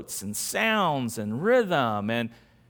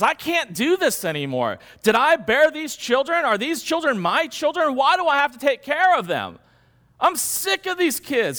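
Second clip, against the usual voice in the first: higher and louder, fed up and complaining; exasperated Moses; 9 semitones higher, 5 dB louder than usual. Retold or imitated. Moses crying out to God, sick of the people, exasperated outburst. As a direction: vent like a leader at the end of his rope. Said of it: "Moses turns to God and he says".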